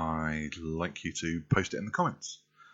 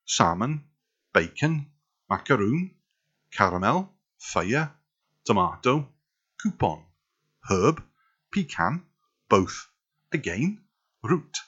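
sing-song South Wales lilt, rising endings; South Welsh accent; 2 semitones higher, 4 dB louder than usual. Shown as a demonstration. Word list for viewers in sing-song South Welsh accent. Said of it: "South Welsh accent is a little bit".

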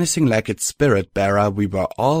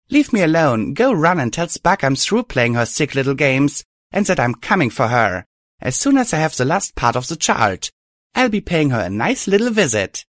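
higher and louder, seething; angry threat; 6 semitones higher, 4 dB louder than usual. A narrator's reading. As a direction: warn her off with fury. Hero threatening the villain.

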